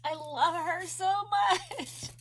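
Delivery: high, teary voice, moved; tearful. Real, not acted. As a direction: talk through happy tears. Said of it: "Sorry, guys, for bawling".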